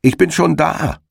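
called out high and smug; a mild, high triumphant call. Acted. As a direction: sing out from the far end of the field. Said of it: "rief ihm der Herr Igel entgegen".

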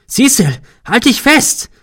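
high, loud, urgent yell; alarmed shout. A narrator's reading. Man to his friend sliding down the roof, shouting in alarm. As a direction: yell it to save a falling friend.

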